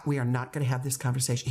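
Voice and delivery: fast, level repeat of a boundary; firm, unraised voice. Shown as a demonstration. Teacher talking over student's protest, firm and level. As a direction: cut in evenly, never raising the voice. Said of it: "my voice is not going up".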